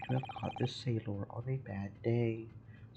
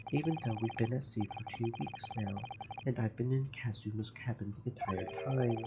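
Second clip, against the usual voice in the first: ordinary talk, nothing marked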